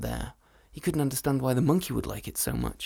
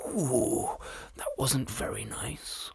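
low hushed aside; whispered grumble; 4 semitones lower, 6 dB quieter, slower than usual. Acted. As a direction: mutter it under the breath. Dragon muttering aside.

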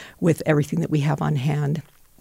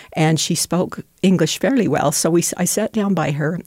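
ordinary talk, nothing marked

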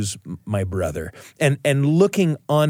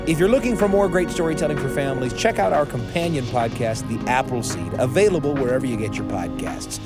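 ad read; 2 semitones higher than usual. Acted. Promo read repeated.

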